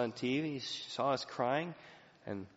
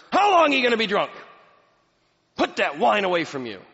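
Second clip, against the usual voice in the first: loud, high scolding rebuke; accusing rebuke; 8 semitones higher, 12 dB louder than usual. Retold or imitated. Eli rebuking Hannah as drunk, loud accusing scold. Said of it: "He says to her".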